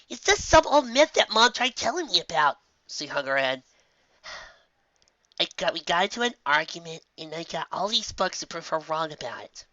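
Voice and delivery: a filly confessing an argument with Mom; sheepish young filly. Acted. Filly voiced, sulking.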